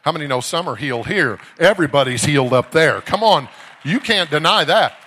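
full-force, high, shouted exhortation; fired-up preaching. Real, not acted. Preacher asking church how many know some are healed, fired up. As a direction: rouse the whole congregation at full volume.

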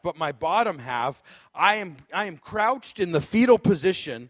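normal talking voice

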